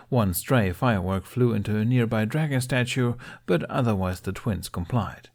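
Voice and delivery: ordinary delivery, nothing marked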